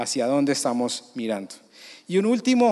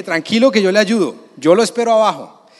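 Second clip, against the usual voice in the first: calling up; 5 semitones higher, 7 dB louder than usual. Retold or imitated. Calling up to friend on slide.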